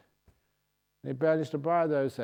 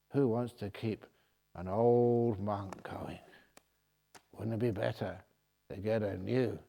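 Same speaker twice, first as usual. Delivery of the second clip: whispered punchline; 3 semitones lower, 4 dB quieter than usual. Shown as a demonstration. Repeated question, whispered take.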